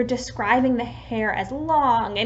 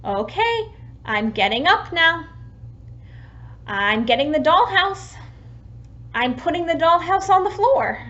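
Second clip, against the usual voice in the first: put-on louder running commentary for a toddler; narrating for a child; 5 semitones higher, 4 dB louder than usual. Shown as a demonstration. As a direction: talk a child through lunch prep. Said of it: "Another example could be".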